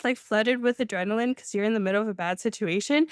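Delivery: ordinary talk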